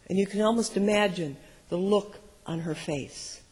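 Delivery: usual speaking voice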